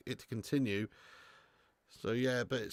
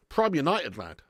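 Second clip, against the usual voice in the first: ordinary talk, nothing marked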